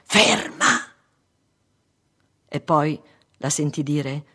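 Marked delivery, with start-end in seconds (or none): 0.0-0.9 loud, high burst; sudden sharp shout; urgent cry. A narrator's reading. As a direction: cry out to stop the coach. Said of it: "gran voglia di gridare".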